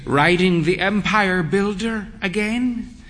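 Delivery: put-on high teasing old mother; old mother's voice. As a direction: tease him like a fond old dear. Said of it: "she said".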